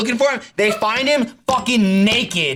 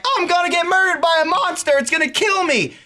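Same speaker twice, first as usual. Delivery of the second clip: high, fretting plea; lightly voiced frightened witness; mock frightened woman; 10 semitones higher than usual. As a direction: fret like a woman hunted. Voicing a scared woman.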